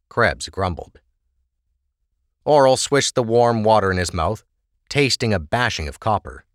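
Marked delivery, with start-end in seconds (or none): none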